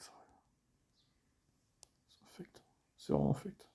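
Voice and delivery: whispered in disgust. Real, not acted. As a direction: hiss your disgust under breath. Disgusted whisper about game.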